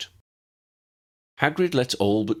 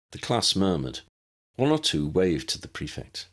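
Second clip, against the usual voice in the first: ordinary delivery, nothing marked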